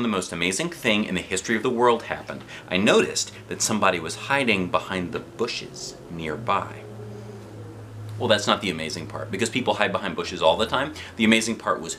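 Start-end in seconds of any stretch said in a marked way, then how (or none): none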